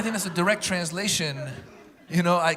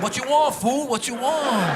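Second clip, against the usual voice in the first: put-on tough kid; 8 semitones higher, 4 dB louder, slower than usual. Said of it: "He's like".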